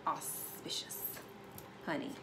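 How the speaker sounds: breathy whispered "Auspicious". Acted. Reader savors the word "Auspicious" in a hush.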